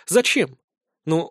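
usual speaking voice

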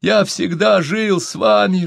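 high, anguished appeal; high, drawn-out lament; sobbing plea. A narrator's reading. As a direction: plead as if through sobs, pitch way up. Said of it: "рыдал Чумбока".

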